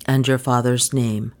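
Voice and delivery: low questioning voice; lightly voiced Rip. A narrator's reading. Rip questions the young woman.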